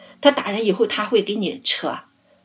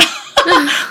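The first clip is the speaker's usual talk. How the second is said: a bright, louder burst of laughter; real laugh; 8 semitones higher, 6 dB louder than usual. Real, not acted. Laughing to the host about mom dragging dad along, a bright real laugh. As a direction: let the laugh break out high.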